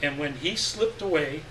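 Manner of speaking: usual speaking voice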